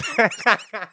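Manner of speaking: high giggling burst; embarrassed laugh. Real, not acted. Host catching herself rambling to listeners, high laugh.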